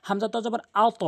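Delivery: আত with the ত bounced upward; qalqala upward bounce. Shown as a demonstration. Teacher showing students an upward bounce, qalqala demo. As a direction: end আত on a bounce that rises upward. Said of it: "আত এখানে উপরের দিকে sound রয়েছে".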